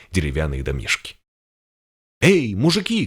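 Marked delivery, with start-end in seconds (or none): 2.2-3.0 shout. A narrator's reading. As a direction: call out to the men. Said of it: "крикнул Синявский".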